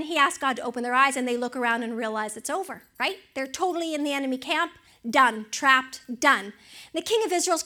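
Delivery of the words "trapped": normal talking voice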